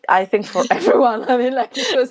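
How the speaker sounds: words broken by real laughter; laughing. Real, not acted. Answering the host about the pandemic, laughing. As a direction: laugh while you answer.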